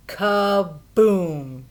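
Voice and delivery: loud booming crash; big crash. A narrator's reading. Narrator sounding the giant's crash, loud. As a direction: boom it out like a fall.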